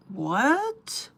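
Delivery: loud, high rising "what?"; stunned, incredulous retold outburst; shocked. Woman reacting to friend's secret meetup offer, shocked. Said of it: "I was so shocked".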